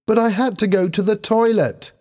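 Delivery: very high-pitched defensive cry; shouted protest. A narrator's reading. Helen shouts back at Lisa in defence, shouted protest. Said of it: "Helen shouted at Lisa".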